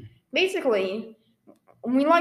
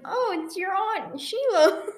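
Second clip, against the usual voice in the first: mock aunt voice; 8 semitones higher, slower than usual. Retold caller voice.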